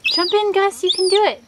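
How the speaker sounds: high, sing-song coaxing of the pet; pet-directed coaxing. Real, not acted.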